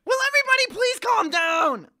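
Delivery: high frantic puppet shout; Kermit the Frog. Acted. Doctor tells delivery room to calm down, Kermit-style shout. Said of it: "talking like Kermit the Frog".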